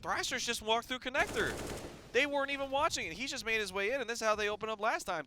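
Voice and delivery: high, hyped call of the action; excited play-by-play. Acted. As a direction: ramp up the energy on the call. Play-by-play rising to high pitch.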